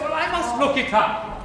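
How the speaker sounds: usual speaking voice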